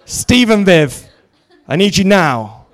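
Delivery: high, loud drawn-out call; mock summons. Congregant jokingly summoning the ministers, calling out loudly. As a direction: holler for help in playful desperation.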